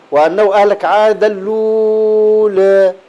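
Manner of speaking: وأنه أهلك عاد الأولى slowly, final vowel tilted toward e; slow chanted verse with taqlil; taqlil reading. Shown as a demonstration. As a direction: recite with Warsh taqlil on الأولى. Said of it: "بالتقليل قولا واحدا".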